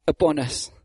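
normal talking voice

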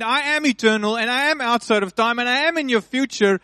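booming pulpit proclamation; full-force preaching. Real, not acted. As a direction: preach it out with fire to the congregation.

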